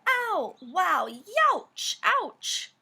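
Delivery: high, loud yelping ouches; hammed-up cries of pain for a kids' book; pained yelps. A narrator's reading. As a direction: yelp like you just sat on a spike. Daddy sits on porcupine.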